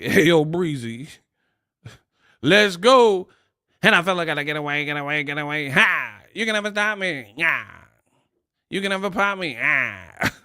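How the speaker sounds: hyped rapper impression, rapid-fire then punched. Host mocking a man who sounds like a rapper, hype rapper impression. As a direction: rattle it off like a wild rap hype man. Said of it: "he sound like the prime [name]".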